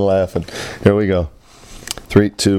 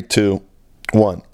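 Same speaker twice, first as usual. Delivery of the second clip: ordinary talk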